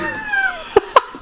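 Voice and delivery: very high, wild cackling shriek; shrieking laugh. Real, not acted. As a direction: let out a screaming laugh.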